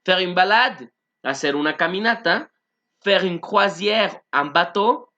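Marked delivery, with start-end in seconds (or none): 3.0-5.1 slow, careful French vocabulary line; slow model phrase. Shown as a demonstration. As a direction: spell it out for the class. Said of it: "vocabulary model phrase".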